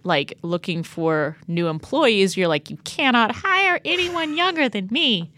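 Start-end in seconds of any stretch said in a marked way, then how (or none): 2.7-5.3 higher whiny mock of Allegra; rising whiny voice. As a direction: climb in pitch, whining like a baby. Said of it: "her voice gets progressively higher".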